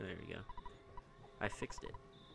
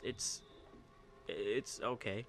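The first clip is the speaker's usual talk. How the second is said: ordinary talk, nothing marked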